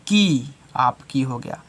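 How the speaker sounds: usual speaking voice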